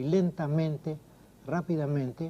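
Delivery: "lentamente, rápidamente", weighted on the -mente ending; slow demonstration, leaning on MEN in lentamente and rápidamente; stress on the ending. Shown as a demonstration. Poet showing cumbersome Spanish adverbs, stress on the ending. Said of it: "the stress falls, let's say, on the non-significant part".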